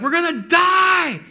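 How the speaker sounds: hammed-up panicked scream, high and drawn out; freaking out. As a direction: shout it in mock terror. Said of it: "they freak out".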